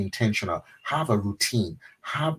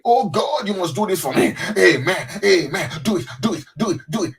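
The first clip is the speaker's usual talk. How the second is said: high, loud, pleading prayer voice; mock frantic prayer; 8 semitones higher, 5 dB louder than usual. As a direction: imitate someone begging God frantically. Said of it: "see people pray".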